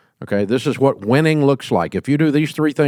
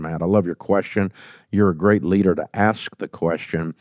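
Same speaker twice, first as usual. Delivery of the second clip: ordinary talk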